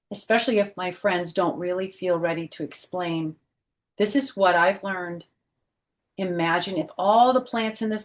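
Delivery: brighter, lively read-aloud voice; storybook reading voice; reading to children. Acted. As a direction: read it out to a class of kids. Reads picture book aloud.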